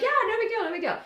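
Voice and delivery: high, loud mock-breezy reply; breezy agreement. Friend agrees to lend her ex, high and breezy. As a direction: wave it off in a high voice.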